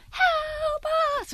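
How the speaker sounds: high, soft, drawn-out wail; eerie distant cry. Retold or imitated. Mysterious noise heard in a dark campsite, eerie faint cry. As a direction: cry for help faintly from far away, stretched out high. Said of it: "the noise is getting louder".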